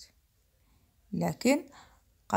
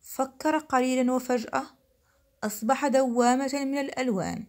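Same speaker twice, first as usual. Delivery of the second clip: plain reading voice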